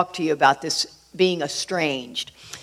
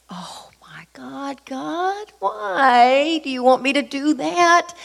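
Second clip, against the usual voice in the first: drawn-out high wail, starting hushed and building; pleading protest; 8 semitones higher, much slower than usual. Retold or imitated. Protesting to God about facing stepmom, exasperated high plea. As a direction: slowly whine upward from a breath to a cry.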